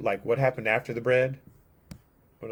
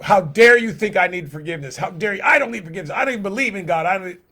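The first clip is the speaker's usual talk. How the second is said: higher, louder, offended protest; bristle, insulted at the idea; indignant; 6 semitones higher, 5 dB louder, faster than usual. Acted. Imagined listener rejecting forgiveness.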